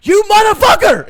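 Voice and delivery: high, full-voiced mock scream; furious. Scammer finally realizing she's been strung along, furious scream. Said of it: "they're so mad at the end of it".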